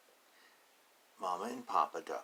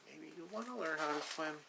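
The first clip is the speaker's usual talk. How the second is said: higher, coaxing mother duck voice; mother duck urging her duckling; mommy duck; 8 semitones higher than usual. Acted. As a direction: gently coax the little one, higher. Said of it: "the mommy duck is saying".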